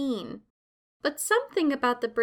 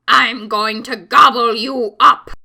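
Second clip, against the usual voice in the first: threatening troll; 10 dB louder than usual. A narrator's reading. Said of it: "stopped by the troll".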